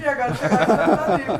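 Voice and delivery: laughing loudly while talking; words through laughter. Real, not acted. Laughing echo of friend's joke.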